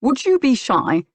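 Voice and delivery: mildly exasperated query; some exasperation. A narrator's reading. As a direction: press the point, a bit exasperated. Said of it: "he clarified with some exasperation".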